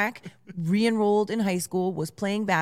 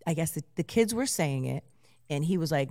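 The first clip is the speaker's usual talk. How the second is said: ordinary talk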